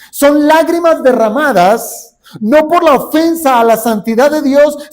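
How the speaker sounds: full-volume pulpit preaching; fiery preaching. Acted. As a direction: preach it out, driving the point home.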